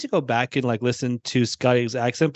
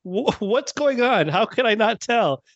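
higher-pitched, incredulous self-reproach; baffled self-questioning; 7 semitones higher than usual. Retold or imitated. Podcaster retelling missing that it was Scotty, baffled. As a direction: replay your own bewilderment, pitched up. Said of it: "and I'm like".

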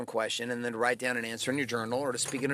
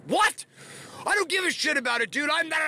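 high-pitched, loud re-enacted outburst; super angry; 13 semitones higher, 4 dB louder than usual. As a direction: protest hotly, voice shooting way up. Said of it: "And I get super angry".

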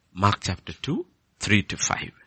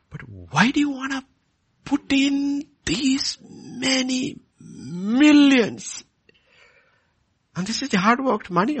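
impassioned preaching. Acted. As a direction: press each indignant question home. Preaches indignant questions at full force.

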